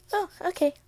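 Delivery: high, loud, breezy acceptance; sudden acceptance. Acted. Mother accepts her daughter's boyfriend, suddenly breezy. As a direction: pop it up high, suddenly fine with it.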